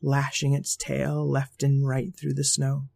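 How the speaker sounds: plain reading voice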